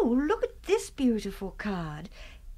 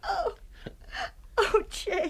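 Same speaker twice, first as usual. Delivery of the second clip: tearful; 7 semitones higher, 3 dB quieter than usual. Acted. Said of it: "Oh, don't cry, Helen".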